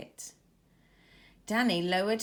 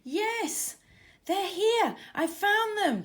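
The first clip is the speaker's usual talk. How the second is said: high, loud cry of discovery; excited shout; 12 semitones higher, 5 dB louder, slower than usual. A narrator's reading. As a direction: call out in triumph, much higher and louder. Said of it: "Danny shouted".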